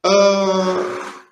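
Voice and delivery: usual speaking voice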